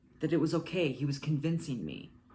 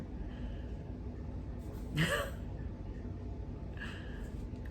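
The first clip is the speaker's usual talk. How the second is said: soft laugh; 8 dB quieter than usual. Real, not acted. Laughs fumbling with clicker.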